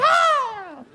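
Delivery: very high shriek of a starstruck fan; imitated fan shriek. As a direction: squeal like you just glimpsed a star. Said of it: "you'd be like".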